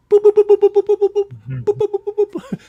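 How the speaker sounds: high rapid boop-boop-boop; imitated bionic beeping. Host joking about Steve Austin, high imitated bionic sound effect.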